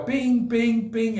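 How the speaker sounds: high sung bell dings, bing bing bing; ding it out like a bell going off; high playful bell sound. Retold or imitated. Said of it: "It's like hitting the bell".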